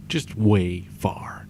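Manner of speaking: slow whisper of "Just way far". Real, not acted. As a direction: breathe "way far" out under the breath, slowly. Repeating it to herself.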